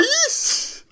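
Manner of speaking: very high squeak on race; squeaky hero retake. Acted. The retake of the line, squeaked very high. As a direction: pitch the question way up as a joke. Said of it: "take two".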